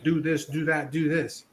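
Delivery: flat robotic instructions; monotone robot-like commands. Retold or imitated. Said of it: "you're being robotic".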